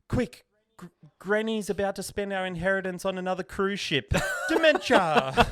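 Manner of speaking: urgent, high, loud spell-caster, laughing on the last word; hammed-up wizard casting. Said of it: "they're like".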